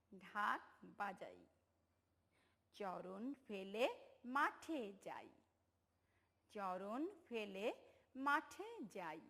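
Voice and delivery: teacher leading a choral read; slow choral reading. Shown as a demonstration. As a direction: lead the class line by line, slowly. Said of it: "আমরা একসাথে বলি সবাই".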